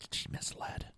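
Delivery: whispered. Shown as a demonstration. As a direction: whisper "She misled." softly, voiceless. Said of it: "No, she lied".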